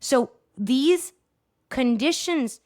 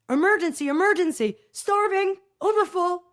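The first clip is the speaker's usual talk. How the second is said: high, urgent mock alarm calls; 7 semitones higher than usual. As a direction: imitate a body sounding its alarms, pitched up. Acting out the body's alarm states.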